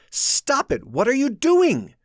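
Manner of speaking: high loud panic, cracking; panicked, voice cracking. A narrator's reading. Josh panicking as Mary is dragged, cracking voice. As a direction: let the voice break in panic. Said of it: "Panic made my voice crack".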